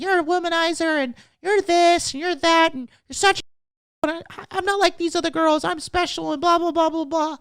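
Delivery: high mocking girlfriend voice with fast, whiny ranting; mock angry girlfriend. Mocking a girlfriend's angry texts, high whiny voice. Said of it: "She sends you, like, a wall of texts, like".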